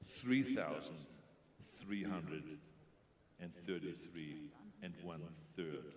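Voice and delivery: three thousand with a clear th; careful TH. Shown as a demonstration. Accent coach drilling the th sound. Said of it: "you have to have said T H".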